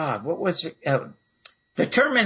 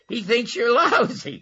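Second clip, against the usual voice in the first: laughing through punchline; 7 semitones higher than usual. Real, not acted. Punchline of retold phone call.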